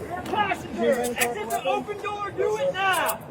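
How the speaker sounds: far-off high commands; loudspeaker commands. Real, not acted. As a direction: order them out from a distance. Felony stop commands from afar.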